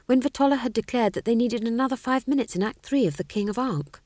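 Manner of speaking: ordinary delivery, nothing marked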